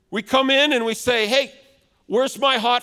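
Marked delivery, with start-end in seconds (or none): none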